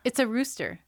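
loud, disbelieving repeat; incredulous. Real, not acted. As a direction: say it like you can't believe it. Incredulous repeat of rooster claim.

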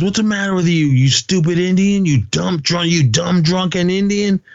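high-pitched retold tirade, normal volume; mock screamed insults. Retelling a tirade after the car is rammed, mock screaming. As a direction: imitate an angry rant, pitched far up. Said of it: "Peter starts screaming".

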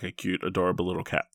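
ordinary talk, nothing marked

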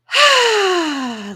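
loud high sigh falling down; dramatic sigh. Shown as a demonstration. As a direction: heave a big, noisy, falling sigh. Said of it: "When you breathe out".

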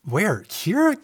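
"Here?" shot up very high in disbelief; incredulous. Acted. Husband stunned that she doesn't fit in. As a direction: sound floored by the idea.

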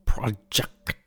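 fading whispered echo. Acted. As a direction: breathe it like a distant echo. Echoing the show name.

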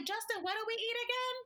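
high, quick, scatterbrained question; ditzy rambling question. Retold or imitated. To the border agent, rambling high and fast.

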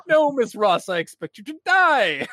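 smug villain line, slightly high; Bond villain. Retold or imitated. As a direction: savor it like a movie villain. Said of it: "sound like a Bond villain".

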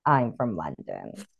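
low, slow London accent. Shown as a demonstration. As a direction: drop deep into a soft London accent. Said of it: "it gets, like, really cockney".

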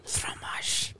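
very soft whispered French. Pretend. Guest keeps teasing with French, in a mock-French whisper. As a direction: breathe the French word out in a whisper.

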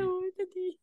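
high cooing pet voice; doggy voice. Pretend. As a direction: coo it like a pet. Joining the doggy voice bit.

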